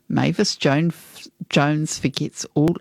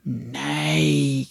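put-on old woman voice; 4 dB quieter than usual. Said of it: "came a voice".

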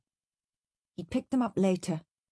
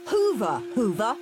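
ordering; 5 semitones higher, 3 dB louder than usual. A narrator's reading. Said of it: "ordered Henry".

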